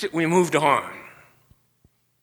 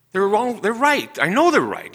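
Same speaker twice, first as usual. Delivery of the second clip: high, stubborn and a bit louder; stubborn retort; 9 semitones higher, 4 dB louder than usual. Retold or imitated. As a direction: refuse to budge, getting heated. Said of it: "I was rude, I was mean".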